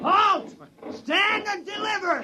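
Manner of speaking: shouted holdup. Acted. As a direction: bark the holdup at them like a highwayman. Tom ambushes the inspector.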